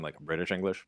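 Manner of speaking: usual speaking voice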